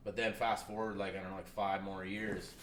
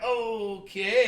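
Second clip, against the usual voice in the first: sheepish okay; 11 semitones higher, 10 dB louder than usual. Retold or imitated. Meek reply after callback.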